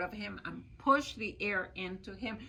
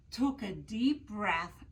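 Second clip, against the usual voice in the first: ordinary delivery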